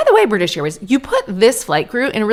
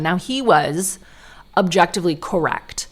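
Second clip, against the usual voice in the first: ordinary talk, nothing marked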